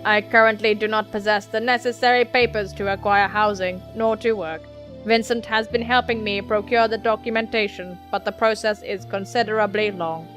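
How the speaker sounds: even, monotone robot voice; level-pitched android delivery, sliding up on odd words; stilted android. Acted. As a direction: speak mechanically, every word measured.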